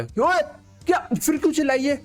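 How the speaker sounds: sudden high shout of an annoyed dad; father's yell. Retold or imitated. Dad shouting at noisy kids from the next room. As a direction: bark at the kids like an irritated father.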